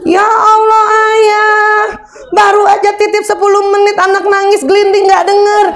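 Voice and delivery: high-pitched exasperated nagging; mock scolding. Wife scolding husband who didn't hear the baby, high exasperated nagging. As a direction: scold him like a fed-up wife. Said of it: "Nanti istri marah-marah lagi".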